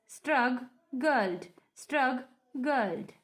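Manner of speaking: STRUGGLED broken in two, strug-gled, with a pause between; split into syllables. Shown as a demonstration. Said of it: "here we have two syllables".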